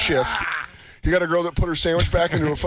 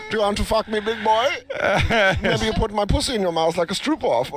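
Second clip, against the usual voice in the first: put-on German accent; 5 semitones higher than usual. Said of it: "I don't know why I'm making German".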